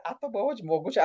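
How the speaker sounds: lightly voiced grand lady, repeated; retelling the line for a colleague; posh Mrs Kapoor voice. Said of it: "I don't talk like this".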